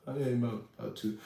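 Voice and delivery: mumbled low and soft, barely there; low mumble. Retold or imitated. Gym guy answering a check-in, mumbled softly. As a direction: shrug it off under your breath. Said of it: "He's like".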